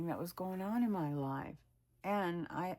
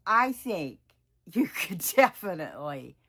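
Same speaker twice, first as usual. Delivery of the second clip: louder, emphatic retold vote of confidence; emphatic encouragement; 11 dB louder than usual. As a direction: act out a friend's firm backing, louder. Acting out friend's emphatic encouragement.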